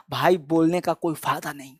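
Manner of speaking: low, soft resigned remark; acted example line. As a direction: mutter it like a fed-up friend. Example quote in dialogue. (दोस्त को धीमी, निराश आवाज़ में कहना)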